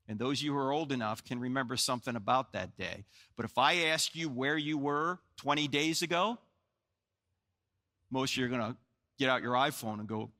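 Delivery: ordinary talk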